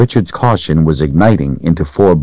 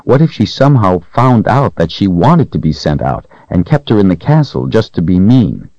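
ordinary delivery, nothing marked

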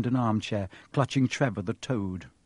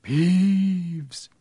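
high, drawn-out hoarse stage whisper; 6 semitones higher, 4 dB louder than usual. A narrator's reading. Harry posing as the Baron to fool Peeves, hoarse whisper. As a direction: stretch it out like a hoarse ghost. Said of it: "he said in a hoarse whisper".

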